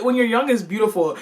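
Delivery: usual speaking voice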